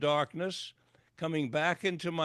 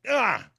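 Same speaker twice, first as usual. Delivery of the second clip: retold cry of pain; 7 semitones higher, 6 dB louder than usual. Leg yanked in emergency room.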